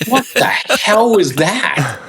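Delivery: very high, drawn-out squeal of disbelief; mock bewilderment. Hikers reacting as stranger drives away, comically incredulous. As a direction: stretch it out high, utterly baffled.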